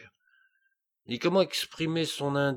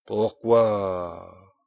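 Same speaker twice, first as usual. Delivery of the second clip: furious; 2 semitones lower, 6 dB louder than usual. A narrator's reading. King turning on the dwarf in fury. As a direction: growl the question in fury. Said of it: "avec fureur".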